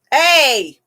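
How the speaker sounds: loud, high warning bark; sharp shout. Real, not acted. Owner yelling down at the squabbling cats, sharp shout. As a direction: cut them off with a sharp call.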